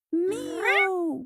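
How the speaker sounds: high, long-drawn meow; cat meow. A narrator's reading. An unseen cat startles Winnie, high meow. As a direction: mew like a cat. Stray cat voiced in story.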